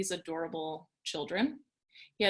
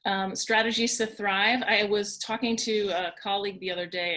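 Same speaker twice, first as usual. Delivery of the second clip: normal talking voice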